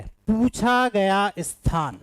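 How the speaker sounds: drawn-out, deliberate formula; teacher stretching each word out; slow dictation. Real, not acted. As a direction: spell it out slowly for the class.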